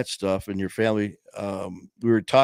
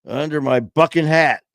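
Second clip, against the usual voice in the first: put-on kid punchline; 6 semitones higher, 6 dB louder than usual.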